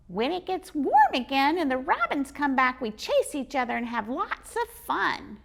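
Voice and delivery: high squeaky squirrel, chatty; cheerful squirrel telling its day. Acted. Squirrel describing its life to the boy, squeaky.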